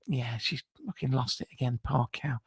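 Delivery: very low, hushed aside; muttered aside. Pretend. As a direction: drop right down under your breath. Aside while reading her text.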